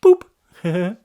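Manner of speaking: loud bright Boop; playful boop. Real, not acted. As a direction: pop it out like a surprise tap. Booping the dog.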